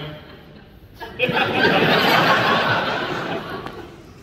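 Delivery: breathy burst of laughter. Real, not acted. Actor recalling a childhood movie shock, laughing. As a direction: laugh off the memory.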